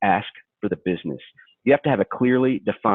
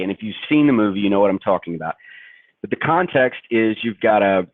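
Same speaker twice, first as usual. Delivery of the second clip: ordinary delivery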